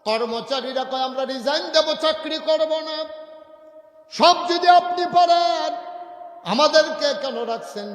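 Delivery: high, very loud preacher's outcry; protesting shout. Acted. Workers threatening Umar with resignation, protesting shout. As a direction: shout the protest, stretching phrase ends.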